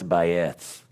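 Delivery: "Bayeth" opened into two syllables, ending in th; two-syllable th word. Shown as a demonstration. Teacher offering the prettier word, two syllables. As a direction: open out "Bayeth" softly to its th. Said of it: "It's a prettier word".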